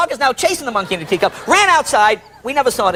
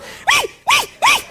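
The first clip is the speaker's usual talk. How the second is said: high squealing critter noise; creature shriek. Retold or imitated. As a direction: let out a shrill animal squeal. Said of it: "Just some kind of thing goes".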